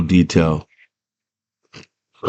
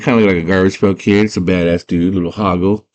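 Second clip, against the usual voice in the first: ordinary delivery, nothing marked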